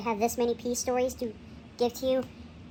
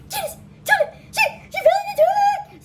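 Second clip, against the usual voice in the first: much higher, louder, half laughing half crying panic; panicked child; 8 dB louder than usual. Retold or imitated. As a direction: blurt it out in shrill kid panic. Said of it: "I'm like".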